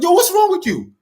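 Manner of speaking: very high-pitched exasperated outburst; annoyed father. Retold or imitated. Father fed up with his son's repeated plea, mock annoyed outburst. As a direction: imitate an irritated dad, pitch shooting up. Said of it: "such annoyed at me".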